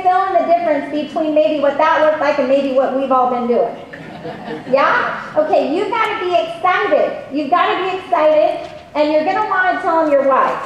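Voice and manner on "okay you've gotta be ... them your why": ordinary talk, nothing marked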